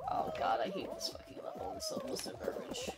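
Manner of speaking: whispered complaint. Real, not acted. Frustrated aside while playing.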